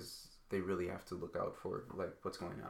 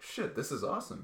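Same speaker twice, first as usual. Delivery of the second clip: put-on excited kid; 7 semitones higher than usual. Kid at recess who finds the phone cover flies, excited. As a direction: blurt it out like a kid finding a new toy.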